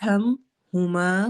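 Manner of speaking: ہما with the ha from the middle of the throat, said slowly; throaty ha sound. Shown as a demonstration. Teacher shows her class the ha from the middle of the throat, slowly. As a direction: breathe the ha out from mid-throat in ہما, drawn out. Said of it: "ہا کی آواز نکالنی ہے، گلے کے درمیانے حصے سے".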